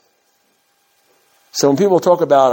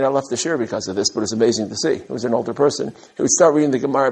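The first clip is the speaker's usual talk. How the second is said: usual speaking voice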